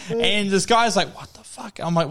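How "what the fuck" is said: whispered, imitated bewilderment; hushed disbelief. Onlooker baffled by the singer in the pool, whispering. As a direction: drop to a stunned whisper.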